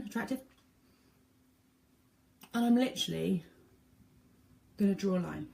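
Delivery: talking with sucked in cheeks. Real, not acted. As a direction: cheeks sucked in while speaking. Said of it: "gonna suck my cheeks in".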